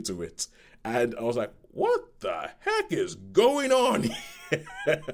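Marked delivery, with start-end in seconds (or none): 1.7-5.0 high, slow, laughing disbelief; stunned disbelief. Retold or imitated. Reviewer reliving his jaw-drop at the school shooting scene, laughing disbelief. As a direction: stretch it out in amazed laughter. Reviewer recalling shock at film.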